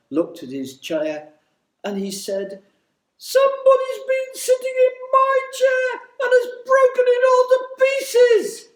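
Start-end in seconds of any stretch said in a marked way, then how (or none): 3.2-8.7 put-on very high, loud baby bear wail; high piping bear voice. A narrator's reading. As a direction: cry out like a tiny upset bear cub. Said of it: "he burst into tears".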